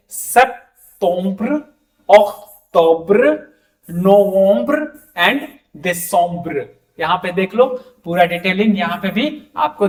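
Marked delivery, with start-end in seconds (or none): 0.1-6.6 "सेप्टेम्बर" to "दिसंबर" read very slowly, each ending in a nasal ombr; slow French months. Shown as a demonstration. Teacher slowly modelling French months for students. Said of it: "ओम्ब्र का sound ज्यादा है".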